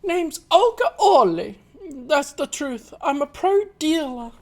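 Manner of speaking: put-on half-formed Russian accent, still finding it; first try Russian accent. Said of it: "I need to get into the Russian voice again".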